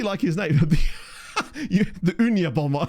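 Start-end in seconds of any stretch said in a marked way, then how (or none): 0.6-1.5 short breathy laugh. Real, not acted. Laughing at the silly name. 1.5-2.9 giggling through the pun; joking while laughing; laughing through a joke. Real, not acted. Laughing at his own pun on a footballer's name.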